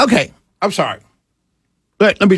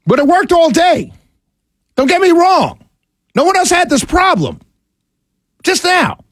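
exasperated; 10 semitones higher than usual. Real, not acted. Exasperated rant over broken equipment.

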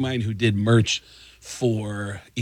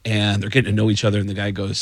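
ordinary talk, nothing marked

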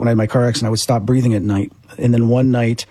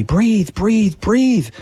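much higher, urgent command to his own body; retold yell; 9 semitones higher, much slower than usual. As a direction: call it out urgently, pitch way up. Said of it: "I'm yelling at my body".

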